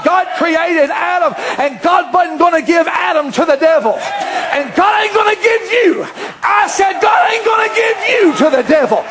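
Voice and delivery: shouted sermon, pitch up high; full-force preaching. Acted. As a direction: thunder it out over the congregation.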